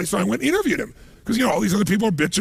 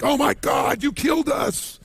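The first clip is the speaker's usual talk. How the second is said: panicked cry; 8 semitones higher, slower than usual. Retold or imitated. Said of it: "they're like".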